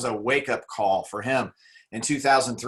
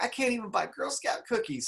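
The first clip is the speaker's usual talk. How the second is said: pitched way up in mock despair; high dismayed voice; 12 semitones higher than usual. Jim Rohn realizing he is broke, high and dismayed. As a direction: squeak out the disappointment. Retold dismay at having no money.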